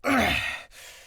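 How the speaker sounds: a loud, pained exhale before the fight; wounded heavy sigh. Shown as a demonstration. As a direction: gather yourself, hurt but ready. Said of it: "they're kinda wounded".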